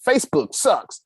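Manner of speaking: high-pitched mock gripe; mock customer complaint. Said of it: "Customers always complain".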